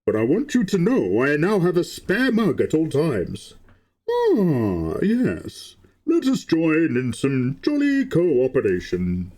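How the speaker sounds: masked villain voice, slowed at the end; big booming masked villain; Bane impression. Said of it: "for Bane impressions".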